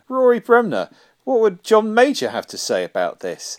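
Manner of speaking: high, loud presenter cueing an impressionist; mock panel-show host. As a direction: throw it over like an overeager TV host. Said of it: "this moment where they'll be like".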